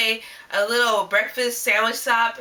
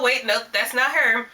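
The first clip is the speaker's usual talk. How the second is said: ordinary talk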